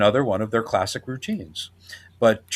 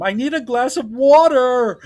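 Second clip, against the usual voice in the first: put-on high, loud childish whine; Stinky's whiny voice; 12 semitones higher, 6 dB louder than usual. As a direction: play a grown man acting like a bratty kid. Imitating Stinky running in.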